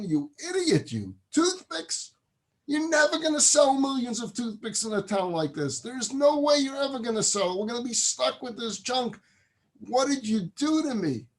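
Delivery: high-pitched, exasperated father's tirade; acted angry yelling. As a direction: scold the son in outraged disbelief. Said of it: "he starts yelling at his kid".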